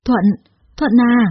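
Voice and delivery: panicked high cry; stammering panic. A narrator's reading. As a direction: stammer his name in horror. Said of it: "lắp bắp nói". (hoảng hốt lắp bắp gọi tên Thuận)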